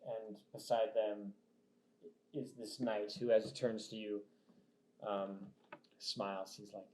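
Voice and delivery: ordinary talk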